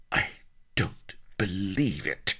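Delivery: grind out each word in frustration; teeth-grinding exasperation. A narrator's reading. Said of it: "Sheba ground her teeth".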